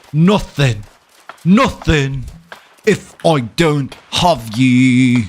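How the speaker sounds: loud, high, slow hammed villain cry; dramatic villain. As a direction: ham it up like a mad overlord. Hammed villain boasting while gaming.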